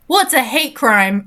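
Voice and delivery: usual speaking voice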